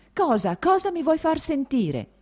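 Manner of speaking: furious outburst. A narrator's reading. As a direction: lash out in fury at her sister. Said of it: "diceva con furia".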